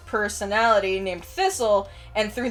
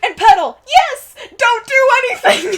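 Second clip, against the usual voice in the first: exasperated captain; 13 semitones higher, 10 dB louder than usual. Acted. Captain warning the clumsy elf, loud exasperation. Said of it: "It's like".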